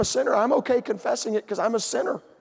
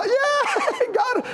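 very high, gleeful squeal; mock cheerful whoop. Sister Angel hugging after being spared, mock cheerful whoop. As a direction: celebrate in a hammed-up high voice.

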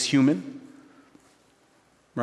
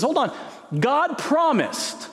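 high, slow dawning realization as Abraham; Abraham catching himself, high and drawn out; Abraham realizing; 14 semitones higher, slower than usual. Retold or imitated. Preacher voicing Abraham's sudden realization, high and slow. Abraham voiced in retold scene.

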